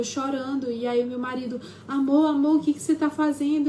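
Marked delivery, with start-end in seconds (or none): 1.9-3.7 high, loud startled cry of a husband; alarmed husband. Retold or imitated. Husband woken in the night, alarmed and loud. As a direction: call out in alarm, louder and higher. Husband wakes to her hitting.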